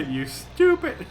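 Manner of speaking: high, loud, drawn-out mimicked scolding; mock exasperation. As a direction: mimic the clip with a slow, punched-up insult. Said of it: "It was the".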